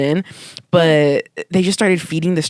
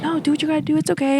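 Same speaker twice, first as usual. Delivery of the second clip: high reassuring quote; 10 semitones higher, faster than usual. Retold or imitated. Self quoted reassuring dad.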